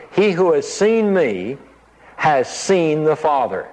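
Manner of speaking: slow, weighty, each word placed; slow solemn declaration. Shown as a demonstration. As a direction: reveal it slowly and solemnly. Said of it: "I'll point him out".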